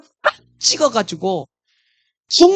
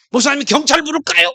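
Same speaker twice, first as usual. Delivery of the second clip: mock angry; 7 semitones higher, 4 dB louder than usual. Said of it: "막 성질 나가지고".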